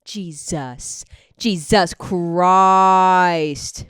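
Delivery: loud, very slow oath; drawn-out astonishment. Real, not acted. Host reading the actor's age, drawn-out astonishment. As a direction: stretch the last word out loudly in disbelief.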